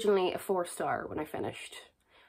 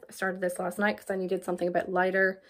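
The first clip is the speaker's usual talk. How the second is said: normal talking voice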